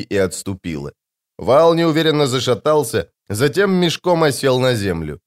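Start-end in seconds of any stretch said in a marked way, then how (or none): none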